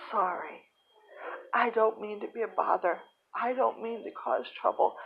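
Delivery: soft, halting, close to tears; tearful apology. Retold or imitated. Crying patient apologises to the nurses, tearful pleading. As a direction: plead softly, pitch lifting as the apologies pile up. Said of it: "I just started to cry".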